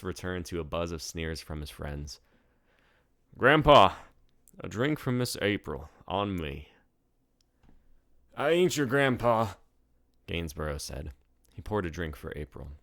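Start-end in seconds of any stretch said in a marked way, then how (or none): 3.4-6.7 put-on loud, higher drunken call; drunk Magnus. A narrator's reading. Drunk tourist calling to the bartender, loud. 8.4-9.5 put-on higher old-timer voice; proud old bartender boasting; old barkeep. A narrator's reading. Bartender telling first-timers the saloon's age, higher old-timer voice. Gainsborough voice.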